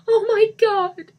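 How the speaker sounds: very high, loud, slow wail. A narrator's reading. Lisa wailing over the body. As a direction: let the wail stretch out. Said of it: "she wailed".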